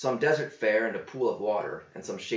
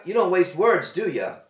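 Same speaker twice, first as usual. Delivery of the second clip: higher, stress on "don't" in "you don't waste words"; wry quip; 8 semitones higher, 7 dB louder than usual. A narrator's reading. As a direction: needle him dryly. John quips.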